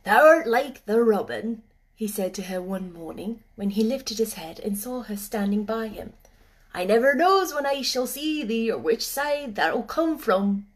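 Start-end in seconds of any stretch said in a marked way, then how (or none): 0.0-1.6 a hint of a gruff Yorkshire gardener, louder; old working man teasing the girl; lightly voiced old gardener. Gardener Ben teasing Mary, gruff old Yorkshireman. 6.7-10.7 put-on broad Yorkshire old man, gently grumbling; an old gardener half-complaining to a bird; gruff old gardener. Ben grumbling at the robin, gruff old Yorkshireman.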